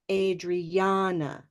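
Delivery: Adriana slow, stressed middle syllable with the olive vowel ah; olive vowel take. Shown as a demonstration. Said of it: "I'm going to model both of these".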